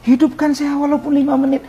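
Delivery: high, insistent begging prayer; retold tearful plea. Man begging Allah for minutes of life, tearful plea. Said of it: "Saya nangis, ya Allah".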